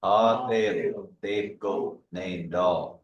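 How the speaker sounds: Ha te lo te ko name dog very slowly; slow repeat. Shown as a demonstration. Student echoing the teacher, very slow. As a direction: echo the teacher word by word. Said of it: "one more time".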